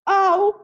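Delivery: high, loud yelp of pain. Acted. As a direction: yelp as the wound is dabbed. Fox wounds being patched.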